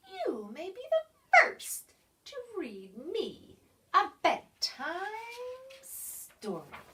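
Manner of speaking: a hint of a commanding creature; lightly voiced bossy creature. A narrator's reading. Creature bosses the pig, lightly voiced.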